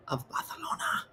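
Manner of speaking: sudden hushed whisper, "Barcelona" with a th; whispered lisped Barcelona. Acted. Leaving the port in a grand memory, whispered lisped Barcelona. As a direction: whisper "Barcelona" reverently, lisping the c. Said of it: "You have to whisper it".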